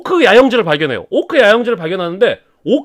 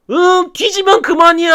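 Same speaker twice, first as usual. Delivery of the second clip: slow, much higher defiant retort; defiant prisoner; 10 semitones higher than usual. Acted. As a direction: taunt the torturer back. Gorlim defies Sauron.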